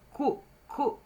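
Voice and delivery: "cu, cu" as separate hard-K syllables; K-sound demo. Shown as a demonstration. Teacher isolating the first syllable of curto, hard K. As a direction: sound out each "cu" on its own. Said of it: "Aqui eu tenho o som do K".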